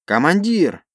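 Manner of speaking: high panicked shout; hysterical cry. Acted. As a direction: cry out in alarm. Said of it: "истерично вскликнул".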